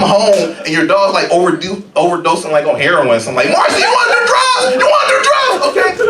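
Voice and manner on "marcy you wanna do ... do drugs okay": high, loud squealing dog pushing drugs; cartoon dog yelling at its owner; pushy dog voice. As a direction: shout it up high like an excited dog. Acting as the drug-pushing dog.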